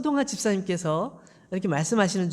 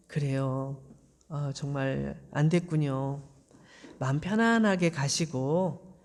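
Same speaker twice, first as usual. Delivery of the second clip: lower, softer, sorry and gentle; apologetic comfort; 6 semitones lower, 4 dB quieter than usual. Acted. Eli apologizing and comforting Hannah, lower and softer. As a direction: soothe her as you send her off. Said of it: "이렇게 위로해 주고 보냈어요".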